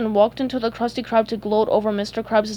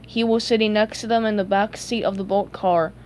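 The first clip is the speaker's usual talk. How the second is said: ordinary delivery, nothing marked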